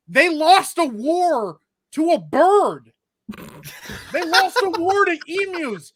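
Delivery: slow, loud, incredulous punchline; slow mock-outraged jab about the emu war; comic outrage. Real, not acted. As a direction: drag it out like it's unbelievable.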